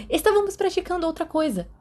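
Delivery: blurted out high and fast; bursting out. A narrator's reading. Peter bursts out his secret to Remus, high and eager. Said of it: "Peter explodiu".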